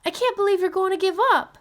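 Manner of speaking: put-on high, indignant child's voice; a girl protesting her father; upset young girl. A narrator's reading.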